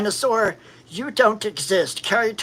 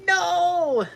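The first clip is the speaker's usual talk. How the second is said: dismayed no; 8 semitones higher than usual. Retold or imitated. Ex threw his bag away.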